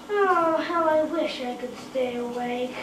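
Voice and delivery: sleepy sigh. Acted. Sleepy dwarf sighs.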